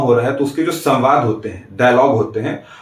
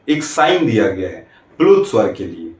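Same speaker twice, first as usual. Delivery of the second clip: ordinary talk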